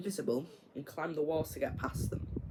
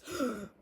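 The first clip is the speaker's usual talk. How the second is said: gasp; 7 semitones higher than usual. Retold or imitated. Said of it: "he's like this".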